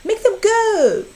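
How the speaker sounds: high, loud, panicky protest; the fish squealing to throw them out; alarmed fish. A narrator's reading. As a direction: cry out in alarm at the intruders. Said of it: "our fish said".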